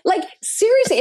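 high mock gangster dare through laughter; hammed-up tough-guy threat; laughing mock dare. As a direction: laugh while daring them again. Echoing the dare.

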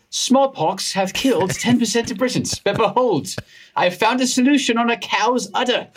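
high-pitched Received Pronunciation; RP accent. Acted. Doctor reading his own mean tweet as Edward Jenner, in high RP. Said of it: "pulling in this R P accent".

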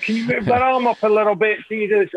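a hint of a fussy makeup person asking politely; imitating the makeup artist. Makeup artist giving directions.

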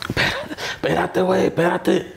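high-pitched imitated plea to wait; urgent bandmate. Bandmate chasing him toward the road, imitated high urgent plea. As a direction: re-enact urging a friend to stop.

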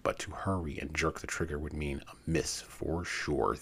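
ordinary delivery, nothing marked

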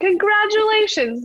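slow, high, loud congratulation in the coach's voice; imitating coach. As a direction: stretch it out like the coach breaking big news. Coach announcing her qualification.

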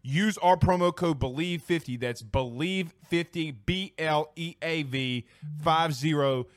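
soft, even sponsor read. Acted. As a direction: read the ad copy calmly. Reads a sponsor ad.

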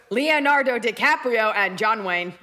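deliberate mechanical name-listing; stilted girl-robot impression. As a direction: imitate a robot naming its crushes. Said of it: "pretending to be a robot".

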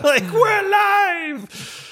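high, slow, stretched-out shout of joy; retold triumphant cheer. Player retelling the downed team's revival, jubilant mock shouting. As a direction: cry it out high, re-enacting a team revival.

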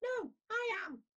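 tiny high squeak; squeaky voice. A narrator's reading. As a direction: squeak it like a small toy. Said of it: "squeaked Chester".

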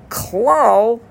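"klal" pitched high and rising; teacher spotlights the word "klal"; stress on klal. Shown as a demonstration. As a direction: lift "klal" up to flag it. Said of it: "double underline the word klal".

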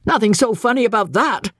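high-pitched firm rebuttal; stoutly dismissive. A narrator's reading. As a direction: stand up for her firmly, pitched high. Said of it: "said Captain Andy stoutly".

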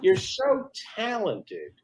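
sweet-then-nasty imitation, drawn out; mock passive aggressive. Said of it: "oh, you're saying".

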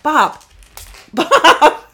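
loud high shout at the cat. Real, not acted. Owner yelling at her cat mid-recording. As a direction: yell the cat's name, half laughing.

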